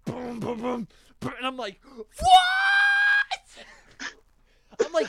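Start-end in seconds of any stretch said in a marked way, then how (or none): 0.0-1.3 high vocal drum booms; imitated drum hits. Host showing the drums after the cut-out, mouthed booms. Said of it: "the drums just". 2.2-3.6 very high, loud, stretched "what"; screamed amazement. Retold or imitated. Host reliving the drop while driving, shrieked disbelief.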